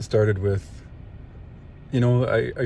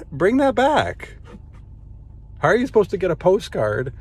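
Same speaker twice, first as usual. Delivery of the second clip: emphatic appeal; 9 semitones higher than usual. Real, not acted. Listener urging the CBC to revive postcards, high emphatic appeal. Mock demand addressed to the broadcaster.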